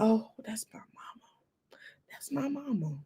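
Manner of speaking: slow, soft, unvoiced wonder; childlike whisper. Mother gazing at her mother's photo, slow whisper.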